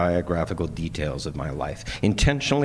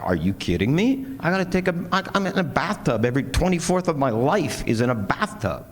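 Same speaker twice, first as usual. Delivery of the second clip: retold indignation, climbing high; worked-up, high-pitched complaint; upset disbelief; 8 semitones higher than usual. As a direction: protest it in mock exasperation, pitch jumping up. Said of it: "at first I was a little upset".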